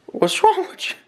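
high incredulous squeak; high-pitched baffled question. Real, not acted. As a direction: sound puzzled by the dog. Baffled at scared dog.

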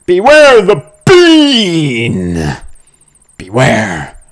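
slow, drawn-out, high doom proclamation; mock ominous warning. Player reading the game's warning in a mock doom voice. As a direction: intone it like a campy horror trailer. Reads game title card.

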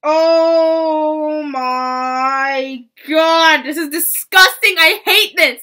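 loud, high cry of disgust; disgusted shout. Real, not acted. Reacting to cringey fan fiction, a disgusted shout. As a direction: shriek it out in real revulsion.

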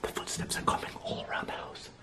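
hushed frightened whisper. Real, not acted. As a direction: whisper so nothing hears you. Hiding, footsteps around house.

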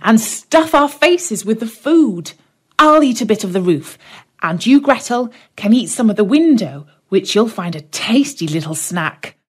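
put-on a hungry boy urging his sister on; Hansel. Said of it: "said Hansel".

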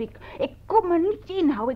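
usual speaking voice

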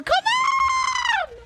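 high, loud plea to the game; screaming come on at the pull; desperate shout. Real, not acted. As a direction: yell at the screen for luck.

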